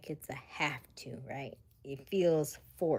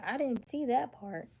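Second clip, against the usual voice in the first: higher-pitched mock disbelief; mock protest; 7 semitones higher, 3 dB louder than usual. People protesting about Bible food rules, mock disbelief. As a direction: play it as people claiming they missed it.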